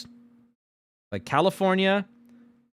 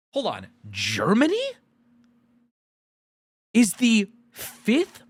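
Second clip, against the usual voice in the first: stunned disbelief; 8 semitones higher, slower than usual. Real, not acted. Disbelief at Germany's rank.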